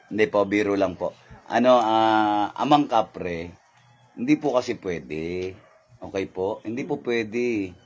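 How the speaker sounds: low, slow, softened pleading; meek backing down. Real, not acted. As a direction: politely placate a powerful spirit. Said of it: "Bibiro lang ako, nagtatapang-tapang lang ako". (mababa at magalang na pakiusap)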